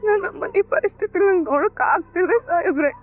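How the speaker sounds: high, tearful pleading with a sob; crying. Acted. Bhoomi pleading with the officer for her jailed mother, crying. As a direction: beg through tears for her mother. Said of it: "ಅಳ್ಬೇಡ, ಸಮಾಧಾನ ಮಾಡ್ಕೊ".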